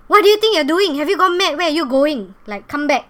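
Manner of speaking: furious high yell at her; angry shouting. Retold or imitated. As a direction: snap at her loud and high. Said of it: "she suddenly very angry".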